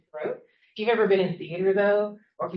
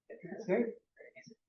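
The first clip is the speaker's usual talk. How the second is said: whispered aside; 11 dB quieter than usual. Real, not acted. Professor telling students rehearsal makes it easy, whispered. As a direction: whisper the end softly. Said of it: "soft aside".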